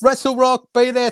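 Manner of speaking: high, punchy promo read; announcer plug. Pretend. Guest plugging Wrestle Rock to listeners, promo announcer voice. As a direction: sell the show like a ring announcer. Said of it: "plugging the show like an announcer".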